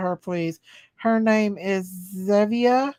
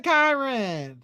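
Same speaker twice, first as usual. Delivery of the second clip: ordinary delivery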